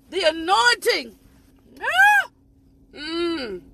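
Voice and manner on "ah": a sudden high, loud ah; fervent cry. Real, not acted. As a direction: cry out as the spirit hits. Preacher crying out in fervor.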